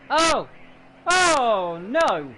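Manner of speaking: loud sudden shocked oh no; shocked cry. Real, not acted. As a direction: react to a crash live. Crash seen live.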